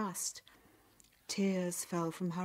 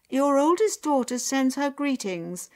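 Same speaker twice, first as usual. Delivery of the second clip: high, loud announcing voice; formal herald-like delivery, pitched up; messenger; 7 semitones higher, 10 dB louder than usual. A narrator's reading. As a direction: proclaim the message to the household.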